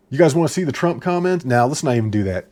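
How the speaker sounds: ordinary talk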